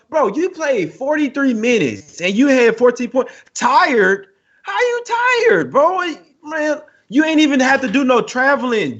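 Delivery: high-pitched, indignant disbelief; incredulous outrage. Real, not acted. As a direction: rant in disbelief, voice shooting up. Indignant rant mocking tired excuse.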